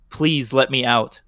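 ordinary delivery, nothing marked

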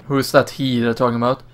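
ordinary talk

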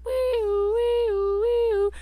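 high loud wee-oo siren; siren imitation. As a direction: howl like an ambulance siren.

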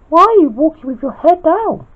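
small, very high falsetto; light falsetto questioner; Peach. A narrator's reading. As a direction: ask in a high little character voice. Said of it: "asked Peach".

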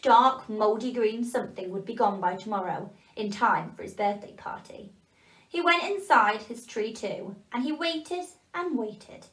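ordinary delivery, nothing marked

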